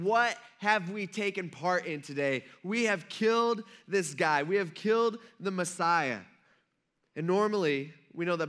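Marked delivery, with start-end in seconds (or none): 0.0-6.2 mild lament. Retold or imitated. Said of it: "go home beating their chest".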